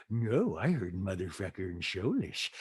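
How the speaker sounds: a hint of a low-voiced gossip; Rinna. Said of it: "And Rena's like".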